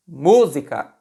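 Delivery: "música" repeated with a strong "mú"; stressed first syllable. Shown as a demonstration. Teacher repeating for students, "música" with stressed first syllable. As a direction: lean on "mú", let "sica" fall away. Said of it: "a sílaba tônica é mu".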